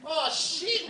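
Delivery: a wasted junkie happily agreeing; dazed junkie. Acted. Junkie player panicking on field.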